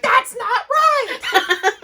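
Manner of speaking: high, slow, laughing mock outrage; hammed-up high squeal; mock indignant commenter. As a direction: shriek it like a fussy commenter pretending to be outraged. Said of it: "she'll be like".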